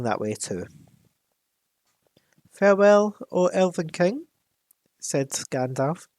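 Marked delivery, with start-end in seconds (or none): 2.5-4.3 put-on loud higher farewell in an unplaced accent; Gandalf's odd accent. Gandalf bidding the Elven King farewell in an unplaced accent. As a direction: proclaim farewell in a vague old-world accent. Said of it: "I don't know what accent that is".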